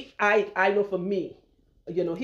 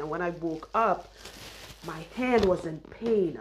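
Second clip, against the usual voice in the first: ordinary talk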